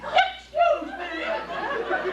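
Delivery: high, drawn-out indignant excuse me. Acted. Son affronted at being called Miss Chloe, indignant. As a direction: stretch it out, deeply offended.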